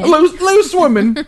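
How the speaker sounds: put-on very high, slow, stretched British accent. Co-host outdoes the guest's British accent. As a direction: drag out Loose Women in a shrill British lilt. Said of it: "Let me do British accent".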